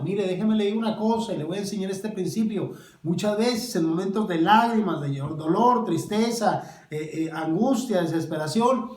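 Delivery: loud, high preacher's build; fervent preaching. Acted. Preacher naming the griefs of the faithful, loud and fervent. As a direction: drive home the point to the flock.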